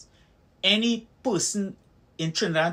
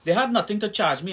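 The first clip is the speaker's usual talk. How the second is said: ordinary talk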